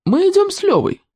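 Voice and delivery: high-pitched, haughty refusal; aloof, snubbing boy; cold reply. A narrator's reading. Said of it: "Петя холодно ответил".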